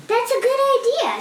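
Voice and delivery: lightly voiced eager boy; boy Tim. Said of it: "said Tim".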